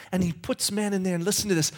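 ordinary talk, nothing marked

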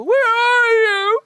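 desperate cry. Retold or imitated. A person calling to God, loud slow desperate cry. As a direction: cry out to the sky. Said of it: "if you as a human go".

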